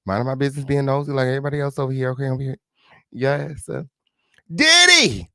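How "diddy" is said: high, loud drawn-out exclamation; loud high-pitched outburst; exclaimed name. Pretend. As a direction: cry the name out high, then let it drop. Exclaims the name in mock outrage.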